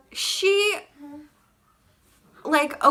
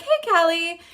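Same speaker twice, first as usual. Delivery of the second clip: high, fake-sweet hello; mock friendly greeting; 6 semitones higher than usual. Girl greeting the person she insulted online. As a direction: put on her fake friendliness. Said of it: "So she's like".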